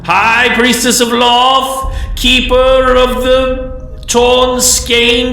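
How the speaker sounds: very high, loud, drawn-out proclamation; obsequious loud herald. Acted. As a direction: proclaim the priestess's titles in a high, fawning cry. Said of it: "in a very obsequious, loud voice".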